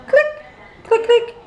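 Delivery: short high "click" sounds; sharp little clicks, one then two; imitated buckle clicks. Husband buckling the child into the car seat, imitated clicking.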